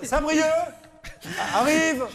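high, very slow, confused announcer; mock a bumbling station announcer; clumsy station announcement. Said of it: "vous entendez ça".

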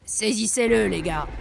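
loud, forceful command falling at the end; yelled order. A narrator's reading. Brigand chief ordering his gang to grab the dragon, yelling. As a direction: order the gang to seize him. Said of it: "hurle Bandeau sur l'œil".

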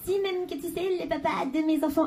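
put-on high, insistent little girl. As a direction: play a stubborn little girl. (petite fille qui insiste)

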